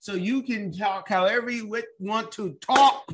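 loud, high-pitched hype for the guest; emphatic encouragement. Real, not acted. Host hypes guest to talk freely.

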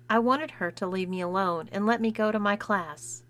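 usual speaking voice

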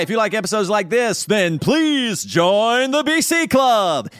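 slow high announcer read. Pretend. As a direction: stretch it out like a radio promo. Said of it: "I'm doing an audition".

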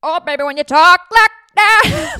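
high, loud [name] imitation; one last playful [name] belt; [name] impression. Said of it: "my [name] impression".

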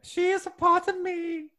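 very high British matron falsetto; defiant falsetto old lady; Missus Doubtfire. Acted. Refusing to put the character away, high matronly falsetto. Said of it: "put Missus Doubtfire away".

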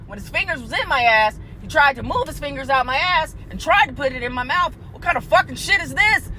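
usual speaking voice

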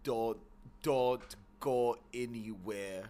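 put-on slow, heavy Austrian accent. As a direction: drag it out like Arnold. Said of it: "Can we do Austrian accents?".

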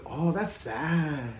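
put-on soft, very slow Chicano-accented drawl; Chicano catchphrase. Quoting the sketch's catch line, drawn-out Chicano accent. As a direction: draw out "sad" quietly in a Chicano accent. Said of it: "because the catch line was".

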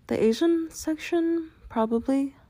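bright reply pitched high above the narration; cheerful. A narrator's reading. Answering a kindly shopper who asked where the udon is, cheerful. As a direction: answer helpfully in a high, chirpy voice. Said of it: "I cheerfully replied".

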